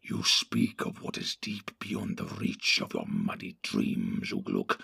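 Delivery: a low menacing creature, scheming; Grishnak the sly orc. Acted. Grishnak threatens.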